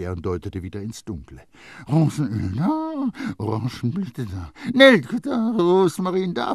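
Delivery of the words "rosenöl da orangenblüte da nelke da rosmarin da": pressed, partly breathy youth voice; Grenouille insisting. A narrator's reading. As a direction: point them out one by one. Grenouille lists scents.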